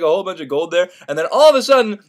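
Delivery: ordinary talk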